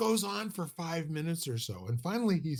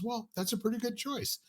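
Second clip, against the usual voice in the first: much higher, sing-song praise from a seminar leader; mock seminar leader praise; 10 semitones higher than usual. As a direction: pat the volunteer on the head with a high, overdone "good choice".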